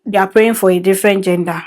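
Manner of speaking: usual speaking voice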